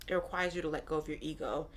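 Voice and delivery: ordinary talk, nothing marked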